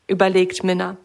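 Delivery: usual speaking voice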